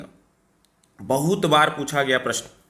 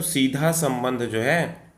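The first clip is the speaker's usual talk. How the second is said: slow emphatic answer; 4 semitones lower, slower than usual. Shown as a demonstration. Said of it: "वर्तनी का सीधा संबंध किससे होता है?".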